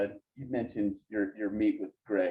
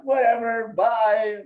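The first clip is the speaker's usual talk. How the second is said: high, louder mock mom brushing it off; dismissive mom; 10 semitones higher, 6 dB louder, much slower than usual. Son mimicking mom dismissing the news, high loud mock voice. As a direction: imitate a careless mom hanging up.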